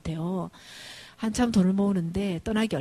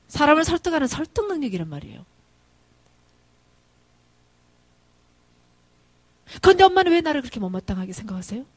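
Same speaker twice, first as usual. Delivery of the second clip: raised, higher-pitched indignant plea; rough protest; 5 semitones higher, 6 dB louder than usual. Retold or imitated. Son defending his persuasion skills to mother, rough protest. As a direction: push back at her, voice lifting. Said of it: "제 아들이 그렇게 거칠게 항변했습니다".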